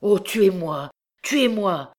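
understated frenzied entreaty; exalted cry. A narrator's reading. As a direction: beg desperately, with a touch of exaltation. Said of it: "s'écria Marius avec exaltation".

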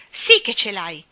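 cruel retort. Acted. Jane mocking the sleepy gardener, cruel. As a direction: throw it back at him cruelly. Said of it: "rispose Jane crudele".

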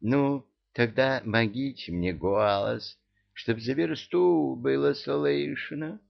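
slow, drawn-out cat voice; talking cat. A narrator's reading. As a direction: drawl it out slowly, pitch climbing. Cat demands a magic voice.

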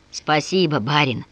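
plain reading voice

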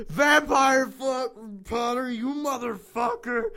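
high, loud, drawn-out shout; hammed-up angry bellow; acted furious yell. A narrator's reading. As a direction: yell the name in outrage. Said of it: "I yelled".

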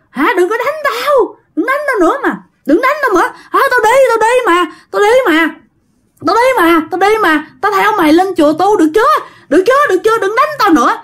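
high, whimpering acted plea; imitated tearful begging, pitched far up; writhing in pain. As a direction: beg frantically for the beating to stop. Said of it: "chửi trong quằn quại nha các bạn".

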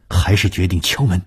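plain reading voice

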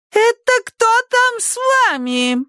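put-on very high shrieking old lady; shrieking neighbour. A narrator's reading. Neighbour screeches at the children, very high voice. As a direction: screech the question. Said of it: "верещала миссис Фонтейн".

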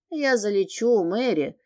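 plain reading voice

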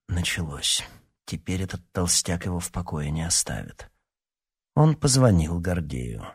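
ordinary delivery

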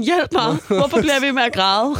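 crying friend begging for help, hammed-up; mock wailing. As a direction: play the friend pleading through tears. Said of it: "hun er virkelig sådan".

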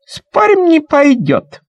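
put-on high, loud child's voice; girl's shrill bet. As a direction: bet it like a cocky little girl. Girl bets it won't.

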